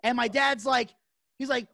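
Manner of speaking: usual speaking voice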